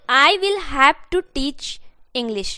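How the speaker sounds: very slow, each word separate; teacher reading the model sentence slowly; slow dictation. Shown as a demonstration. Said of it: "सिखाना पड़ेगा।".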